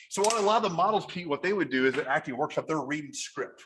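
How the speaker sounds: ordinary delivery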